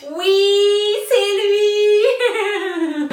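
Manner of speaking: high, loud squeal of delight; real burst of high-pitched joy; overjoyed squeal. Real, not acted. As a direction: squeal it, thrilled at the find. Delighted at finding her favourite product.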